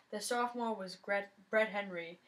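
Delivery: usual speaking voice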